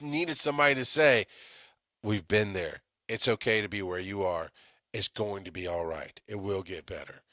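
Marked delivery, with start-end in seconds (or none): 2.0-7.2 softer, lower, fading to near-quiet; gently reassuring. Retold or imitated. The debriefer he needed, comforting him softly and lower. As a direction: soothe someone who is hurting.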